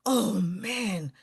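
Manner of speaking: ordinary talk, nothing marked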